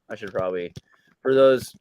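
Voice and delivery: ordinary delivery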